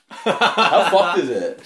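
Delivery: high, loud and slow through a laugh. Real, not acted. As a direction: laugh out the question. Laughing at his own trick.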